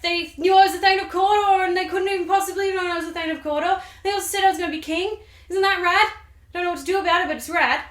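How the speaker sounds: high, loud, breathless-with-news gush; excited Macbeth letter. Acted. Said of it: "But also tells her about the".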